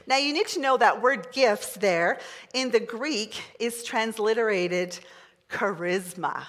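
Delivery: usual speaking voice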